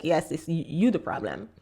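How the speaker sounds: hushed punchline; quiet realisation. Retold or imitated. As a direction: drop it low like a dry verdict. Realising line, quoted inner thought.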